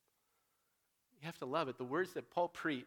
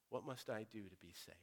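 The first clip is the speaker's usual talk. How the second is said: hushed plea; 5 semitones lower, 11 dB quieter than usual. Retold or imitated. Quiet repeat of the jailer's plea.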